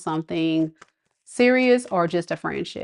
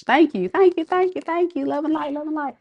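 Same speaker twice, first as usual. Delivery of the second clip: high singsong thanks; 8 semitones higher than usual. Real, not acted. Reader thanks a viewer for twenty dollars, giddy high singsong.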